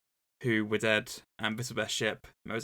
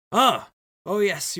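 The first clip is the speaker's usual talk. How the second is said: loud, high delighted cry; excited exclaim; 9 semitones higher, 9 dB louder, slower than usual. Acted. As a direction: pounce on the news gleefully. Duke delighted by the offer.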